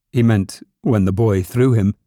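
ordinary delivery

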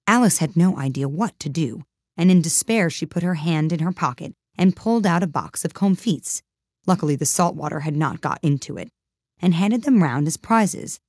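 ordinary delivery

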